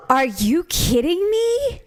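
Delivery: high, drawn-out gasp of disbelief; real shocked disbelief. Real, not acted. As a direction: exclaim it slowly, pitch leaping up. Gasps at news of fight.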